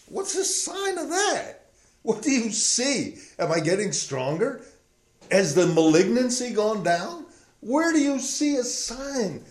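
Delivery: high, pleading voice of a dying king; strained high plea; pleading Hezekiah. Acted. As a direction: beg for proof you'll live.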